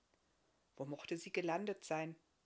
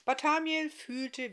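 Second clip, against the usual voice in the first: plain reading voice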